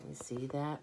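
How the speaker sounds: ordinary delivery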